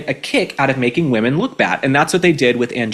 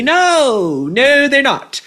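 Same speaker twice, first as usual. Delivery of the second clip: sharp high emphatic no, raised a little; 10 semitones higher, 3 dB louder, much slower than usual. Real, not acted. Vlogger answering her own question, emphatic no. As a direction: slam the answer down hard.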